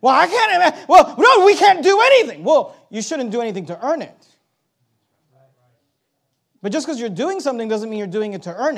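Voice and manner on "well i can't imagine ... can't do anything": high, loud objector's whine of helplessness; mock protest. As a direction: throw up a loud, exaggerated objection. Voices an objector's exaggerated protest.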